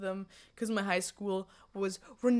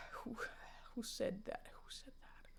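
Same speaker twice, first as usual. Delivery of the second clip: spooked whisper; 15 dB quieter than usual. Pretend. Mock spooked by chat's question.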